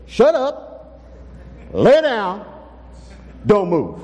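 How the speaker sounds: curt, no-nonsense orders; blunt command. Acted. Jesus in modern slang ordering the storm, blunt. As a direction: bark it like plain orders. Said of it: "He says".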